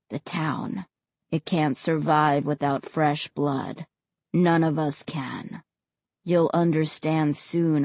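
low, heavy ghost voice; quiet regret, low and cold; deep and cold. A narrator's reading. Ray's voice.

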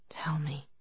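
soft slow whisper; pleading whisper. Acted. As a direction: beg her gently, barely audible. His eyes pleaded with hers.

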